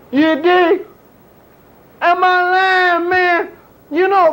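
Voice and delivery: ordinary delivery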